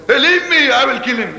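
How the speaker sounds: high-pitched protest, dropping into the threat; blustering threat. Acted. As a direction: act as if struggling against someone holding you back. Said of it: "You know the way he says".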